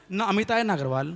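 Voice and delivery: ordinary talk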